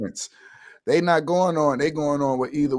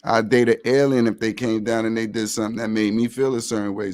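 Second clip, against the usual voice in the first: usual speaking voice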